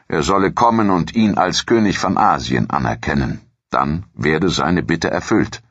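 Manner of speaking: usual speaking voice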